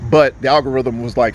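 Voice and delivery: ordinary talk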